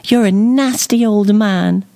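very high, loud little fairy voice; piping bell-like scolding; tiny sing-song fairy. A narrator's reading. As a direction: fling it out as a tiny, indignant fairy. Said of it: "her tiny voice a shambles of sing-song bell ringing".

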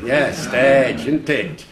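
"stage" loud, with a Lancashire vowel; Lancashire accent. Shown as a demonstration. Lecturer shows the audience how "stage" sounds, Lancashire accent. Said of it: "you think that's Lancashire".

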